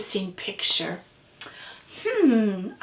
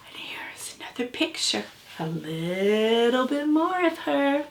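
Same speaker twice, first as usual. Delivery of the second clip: breathy hushed reveal; gasp, then a soft whispered reveal; 6 dB quieter than usual. Real, not acted. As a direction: whisper it like a surprise unfolding. Excited hushed reveal of picture.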